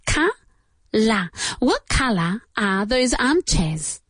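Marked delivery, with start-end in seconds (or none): 0.0-1.3 "Ca- la" split, both vowels open ah; two open a sounds. Shown as a demonstration. Teacher breaking "color" into syllables for students, open vowels. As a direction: say "Ca-" then "la" like kah-lah. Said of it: "Como si fueran dos As".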